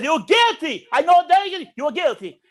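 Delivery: high, loud judge's outburst; mock angry verdict. Pissed-off judge condemning the boy, high mock-angry shout. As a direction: declare guilt in imitated outrage. Angered judge pronounces guilt.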